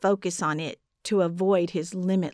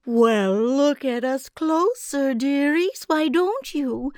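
honeyed giantess; 4 semitones higher than usual. A narrator's reading. Giantess coaxing the trapped children, sugary high menace. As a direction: coo at the children like syrup hiding teeth. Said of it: "basting our ears with honey".

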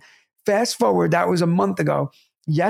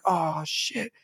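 dismayed; 7 dB quieter than usual. Retold or imitated. Retells his own dismayed reaction.

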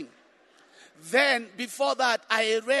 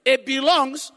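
loud fervent sermon; preaching; 3 semitones higher, 5 dB louder, slower than usual. Real, not acted. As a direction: preach it with full force. Preaching at full force.

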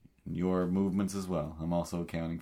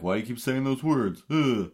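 mocking kid question; 7 semitones higher, 4 dB louder than usual. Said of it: "they start going like".

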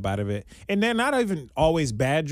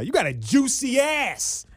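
high, slow, drawn-out street yell; mock aggressive catcall; 12 semitones higher, slower than usual. Stranger yelling at passer-by, high drawn-out mock yell. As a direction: holler it across the street at him. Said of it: "she yells at you aggressively".

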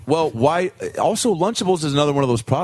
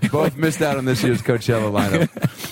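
ordinary talk, nothing marked

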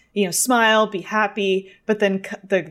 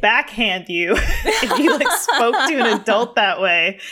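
loud, higher-pitched outrage through laughter; indignant retort; 5 semitones higher, 5 dB louder than usual. Retold or imitated. Eight-year-old reacting to a rude demand, mock indignant. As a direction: drag out the scandalised comeback, laughing.

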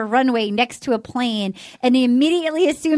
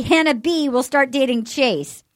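usual speaking voice

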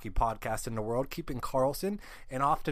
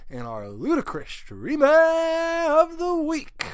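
high, loud and slow drawn-out build; hyped announcer build-up; 12 semitones higher, 8 dB louder, slower than usual. Acted. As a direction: stretch it out for the big reveal. Build-up before big reveal.

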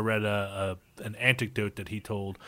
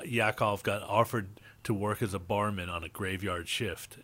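normal talking voice

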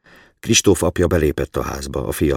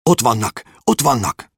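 high-pitched excited exclamations; excited child; 8 semitones higher, 4 dB louder than usual. A narrator's reading. Boy spots the crows for his father, excited and high. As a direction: cry it out like an eager boy. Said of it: "Kristóf izgatottan ugrándozni kezdett".

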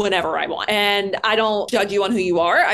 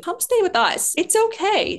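coaxing friends; 11 semitones higher than usual. Retold or imitated. Retelling friends' pleas.

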